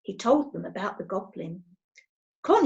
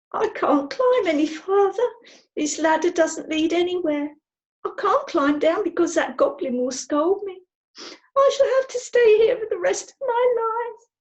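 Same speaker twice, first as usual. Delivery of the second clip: put-on high, tearful girl's voice, rising to a wail; a stuck child on the verge of tears; Connie, stuck on the ladder, whimpering to herself in a high voice; miserable little girl; 8 semitones higher, 6 dB louder than usual. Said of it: "heard a miserable voice above him".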